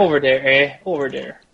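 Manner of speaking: mock Yooper accent with "dere". Player echoing the game master's "over there", mock Yooper accent. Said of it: "except for the Yoopers who are like".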